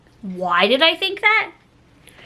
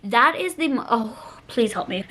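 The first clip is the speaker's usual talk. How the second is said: ordinary talk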